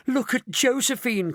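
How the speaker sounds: high, dismayed cry. A narrator's reading. Grandpa Joe pointing at his wife, crying out. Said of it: "cried Grandpa Joe".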